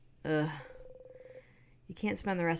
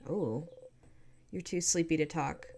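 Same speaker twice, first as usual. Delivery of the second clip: usual speaking voice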